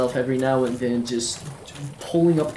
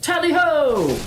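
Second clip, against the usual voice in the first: excited battle cry; 11 semitones higher than usual. Real, not acted. Gamer charging a monster with a playful battle cry.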